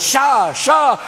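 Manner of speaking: high, curt hushing call; shushing Moses. Acted. Moses silences the complaining crowd, high curt shush. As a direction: hush the crowd at a high pitch. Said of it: "And Moses goes".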